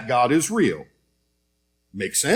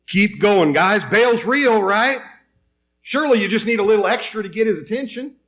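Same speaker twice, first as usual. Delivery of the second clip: higher sarcastic cheer, fast at the end; mocking encouragement; 6 semitones higher than usual. Elijah teasing the prophets of Baal, high mock cheer. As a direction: taunt them with mock enthusiasm. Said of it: "Elijah decides maybe they need a little bit of help".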